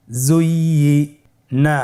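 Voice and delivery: জুইয়িনা stretched slowly, syllables held; slow drawn-out reading. Shown as a demonstration. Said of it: "আমার সাথে বলুন". (ধীরে টেনে জুইয়িনা)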